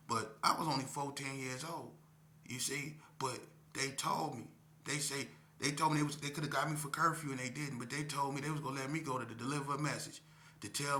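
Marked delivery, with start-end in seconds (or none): none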